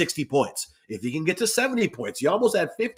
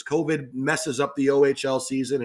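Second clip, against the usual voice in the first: usual speaking voice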